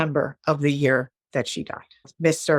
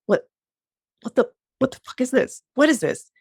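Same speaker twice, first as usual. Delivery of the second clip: higher, fast, incredulous; outraged demand; 8 semitones higher than usual. Retold or imitated. Calling the boyfriend about the Facebook breakup, fast outraged high-pitched demand. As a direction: confront him on the phone, fast and high. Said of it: "I'm like".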